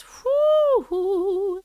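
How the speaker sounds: high "whoo-hoo"; high whoop. Real, not acted. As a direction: let out a high two-part whoop. Whoops between reading comments.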